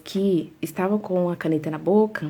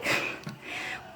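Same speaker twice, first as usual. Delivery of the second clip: breathy laugh; 11 dB quieter than usual. Real, not acted. Laughs at herself.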